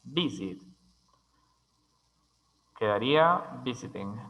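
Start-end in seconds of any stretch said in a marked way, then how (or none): 0.0-0.6 "visit" with the weight on VIS; stress on first syllable. Shown as a demonstration. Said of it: "énfasis de sonido en la primera sílaba".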